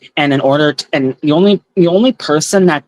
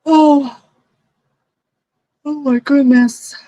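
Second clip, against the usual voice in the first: surprised; 11 semitones higher, slower than usual. Real, not acted. Sudden surprise mid reading.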